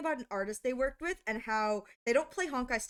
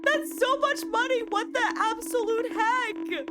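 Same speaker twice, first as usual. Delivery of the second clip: high, drawn-out disbelief; flabbergasted; 7 semitones higher than usual. Real, not acted. Streamer stunned by two thousand bits, flabbergasted. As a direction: exclaim slowly, voice way up. Voicing shock at huge donation.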